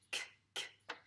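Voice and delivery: "k" as a bare voiceless click; isolated k sound. Shown as a demonstration. Teacher isolating the sound for a pupil, bare "k". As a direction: say "k" alone, no vowel after it. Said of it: "le son k".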